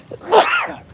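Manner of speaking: sharp high cough; a real sudden cough. Real, not acted. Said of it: "Excuse me".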